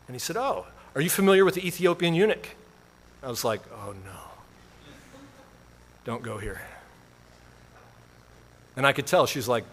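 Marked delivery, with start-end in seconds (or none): 0.4-2.5 slightly higher-pitched eager questioner; lightly voiced chatty man making a connection; a hint of an over-keen stranger; eager customer. Acted quote of overeager customer. 3.7-6.6 low, very soft inner groan; hushed, sinking plea; dismayed aside. Retold or imitated. Inward dread at awkward remark.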